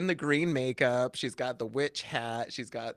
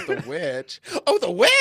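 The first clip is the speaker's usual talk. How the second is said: rising, louder double take; mock surprise; 5 semitones higher, 12 dB louder than usual. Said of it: "he goes".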